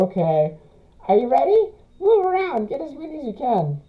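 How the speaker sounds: high, sing-song flower voice; sweet fake-friendly Flowey. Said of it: "that flowery voice".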